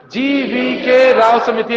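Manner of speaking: GVK Rao समिति spelled out slowly for memorising; slow drilled name. Shown as a demonstration. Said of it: "बोलो एक बार". (रटवाने के लिए धीरे-धीरे)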